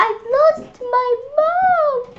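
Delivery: high slow wail of a lost little monkey. Acted. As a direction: cry it out like a lost baby monkey. Baby monkey cries out.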